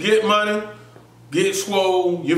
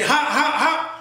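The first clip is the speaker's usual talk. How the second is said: calling out hot; 8 semitones higher than usual. Retold or imitated. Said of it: "just like that".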